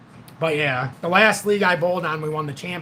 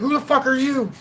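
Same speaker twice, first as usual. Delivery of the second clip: pissed-off coach; 8 semitones higher than usual. Retold or imitated. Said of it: "He's all pissed off".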